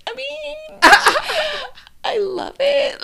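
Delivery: high, forced cheerful voice; squeaky fake enthusiasm; fake-cheerful actor. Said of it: "all of them are just like".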